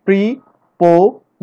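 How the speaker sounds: Preposition drawn out slowly in parts; slow teacher demo of the syllables; syllable split. Shown as a demonstration. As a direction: model preposition slowly for the class. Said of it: "চারটি সিলেবলে ভাগ করতে পেরেছি".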